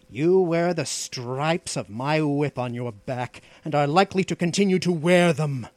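mildly impatient put-down, quickening at the end. A narrator's reading. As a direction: master curtly reminding his slave of his place. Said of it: "answered the outlaw impatiently".

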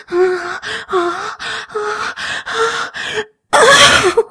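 moans rising to a high loud peak; building climax cries. Acted.

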